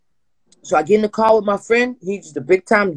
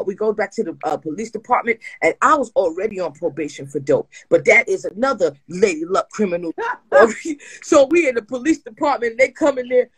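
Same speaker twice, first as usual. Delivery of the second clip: ordinary delivery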